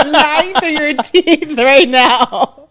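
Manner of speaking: high, loud, slow laughing jab; laughing accusation. Real, not acted. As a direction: tease him while laughing.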